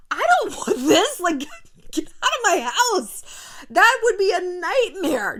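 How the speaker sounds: high, loud protest breaking into laughter; loud, high-pitched, laughing dismay; laughing exasperation. Real, not acted. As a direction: cry out in comic horror, half laughing. Comic horror at having a partner obey.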